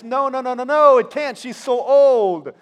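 high, loud, insistent denial; loud, high, slowing on the end; loud doubting protest. Acted. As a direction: push back hard, refusing to believe. Zechariah's doubt acted out.

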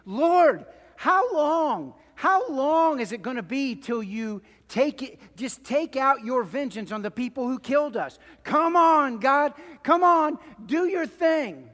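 loud, high, desperate cry for vengeance; martyrs crying out. Acted. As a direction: call out to God from under the throne. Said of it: "They're saying".

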